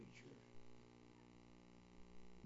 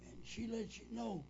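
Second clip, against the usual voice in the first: normal talking voice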